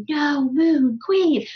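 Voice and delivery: slow, stretched whisper-shout. Retold or imitated. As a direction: shout it under your breath, slowly. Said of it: "that, like, whisper shouting".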